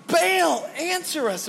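high mock scream with a big pitch jump. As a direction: scream it up high to a silent god. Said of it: "they're just screaming now".

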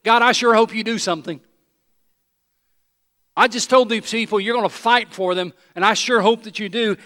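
a worried leader begging God out loud; anxious loud prayer. Retold or imitated. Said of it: "Moses turns to the Lord and says basically this".

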